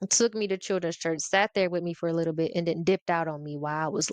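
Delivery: ordinary delivery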